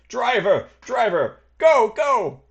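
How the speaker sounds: loud, high, urgent calls; panicked shouting. Acted. Wounded passenger scrambling into the carriage, panicked shouting to driver. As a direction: yell for the getaway in a panic.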